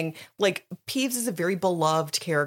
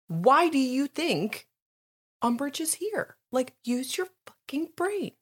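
exasperated warning; 8 semitones higher than usual. Retold or imitated. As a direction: imitate a teacher spelling out the obvious. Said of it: "she's giving him a warning".

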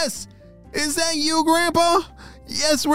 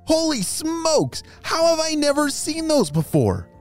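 put-on amazed boy; 13 semitones higher than usual. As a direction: marvel at the scars.

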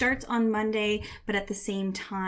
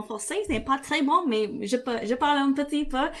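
speaking French with an English accent; English-accented French, a little halting; 6 semitones higher than usual. Real, not acted. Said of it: "I do speak a little bit of French".

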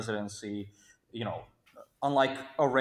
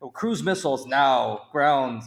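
louder, emphatic imitation of Trump; mock yelling; 2 semitones higher, 7 dB louder, slower than usual. Panelist imitating Trump yelling military threats, mock yell. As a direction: punch it out loud, imitating a blustering threat. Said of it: "was yelling".

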